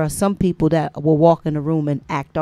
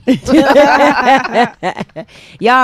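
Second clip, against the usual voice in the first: laughing; 7 semitones higher, 8 dB louder, much slower than usual. Real, not acted. Mocking the prediction, laughing.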